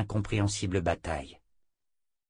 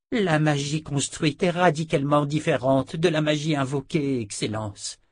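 higher woman's voice, dropping on the title; Perdita, insistent; 6 semitones higher than usual. A narrator's reading. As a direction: correct the emperor, deferential at the end. Sister Perdita answers Jagang. (sœur insistante, voix aiguë)

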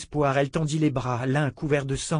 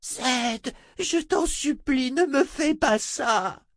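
desperate begging; 13 semitones higher, much slower than usual. Acted. Adi begs.

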